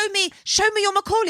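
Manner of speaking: high, eager mock demand; pushy, hammed-up order. Acting out [name]'s demand.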